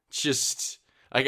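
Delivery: ordinary talk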